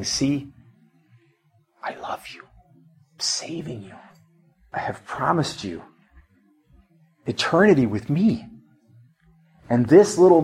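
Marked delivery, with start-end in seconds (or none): 0.0-8.4 soft near-whisper with long pauses, rising at the end; imagine God gently reassuring, barely above a whisper; God's tender assurance. Retold or imitated. Preacher voices God reassuring a weary believer, tenderly hushed. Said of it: "I'm sensing God saying".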